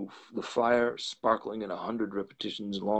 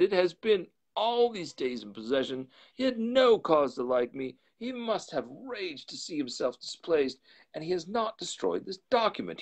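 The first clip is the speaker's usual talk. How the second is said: higher, urgent worried reasoning; agitated; 8 semitones higher than usual. A narrator's reading. Lawyer to Poole after reading the will, high and alarmed. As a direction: voice raised high in alarm, racing to make sense.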